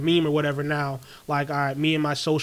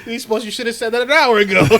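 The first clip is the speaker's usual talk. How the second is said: louder through laughter; laughing tease; 5 semitones higher, 8 dB louder than usual. Real, not acted. Mocking his friend's early goodnight, laughing loudly. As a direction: tease him while cracking up.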